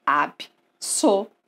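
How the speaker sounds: slow AB, SO, LU-TO with pauses; teacher slowly breaking Absoluto into its syllables; divided into syllables. Shown as a demonstration. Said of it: "Vamos dividir?".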